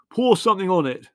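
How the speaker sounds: high, loud, urgent order; shouting. A narrator's reading. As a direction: shout instructions over the panic. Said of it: "shouted Les".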